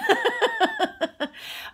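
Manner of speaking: shrieking burst of laughter; high loud laugh. Real, not acted. Laughing at her own story. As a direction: let a big high laugh out.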